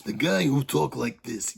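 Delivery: a self-admittedly bad Macho Man voice, gruff and put-on; Randy Savage impression. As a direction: ham up the wrestler's promo growl, knowingly badly. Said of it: "that's a terrible impersonation".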